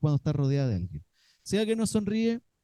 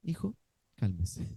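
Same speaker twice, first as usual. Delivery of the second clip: slow, soft, low "Hijo, cálmese"; pretend calming; 6 semitones lower, 7 dB quieter, slower than usual. Father pretending to calm his son, soft and slow. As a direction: coax the child slowly and softly. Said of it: "qué rico sería poder hacer eso".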